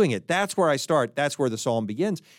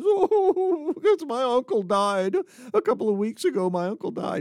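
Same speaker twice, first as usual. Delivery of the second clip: a much higher, whiny crying voice; weeping man; 8 semitones higher than usual. Acted. Joke's crying man wails to his friend about his uncle, high and whiny. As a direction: whimper it out like a grown man bawling. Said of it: "And he says".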